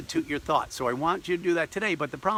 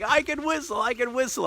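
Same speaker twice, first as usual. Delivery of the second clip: much higher, bursting with joy; excited; 9 semitones higher than usual. Retold or imitated. Bursting into the doctor's office, thrilled, much higher. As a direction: shout it out like a kid who just got good news. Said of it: "I was so excited".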